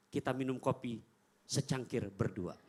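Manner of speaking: soft, low, slow offer; tender invitation. Retold or imitated. Prophet inviting Aisyah to share coffee, soft and slow. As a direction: invite her kindly to share. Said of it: "Rasul panggil".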